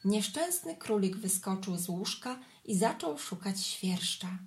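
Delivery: usual speaking voice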